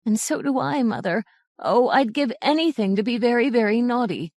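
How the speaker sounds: crying sadly. A narrator's reading. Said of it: "cried sadly".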